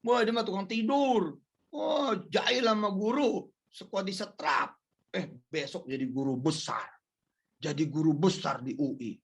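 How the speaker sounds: a hint of chattering neighbours; gossiping people. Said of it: "Kadang-kadang ngomongnya begitu".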